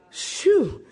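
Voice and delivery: high, falling "whew"; sigh of relief. A narrator's reading. Rider stopped at cliff edge, whew of relief. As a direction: blow out relief after a near miss.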